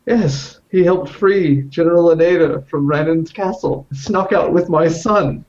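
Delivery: high-pitched, gleeful announcement. Acted. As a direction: revel in breaking the wonderful news. Player gleefully reveals the heir.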